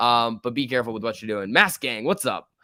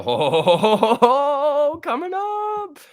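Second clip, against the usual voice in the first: rising, loud drawn-out ho-ho-hos into a high squeal; excited hooting; 14 semitones higher, 6 dB louder, slower than usual. Real, not acted. Streamer cheering a move on stream, excited high hooting. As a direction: hoot higher and higher, stretching it out.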